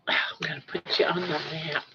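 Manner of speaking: sighing, fast whisper to a pet; whispered promise to a pet. Real, not acted. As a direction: breathe it out on a sigh. Whispers to pet, then repeats.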